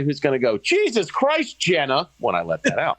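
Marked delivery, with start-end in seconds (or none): 0.6-2.1 mock outrage. Fake outrage at fart.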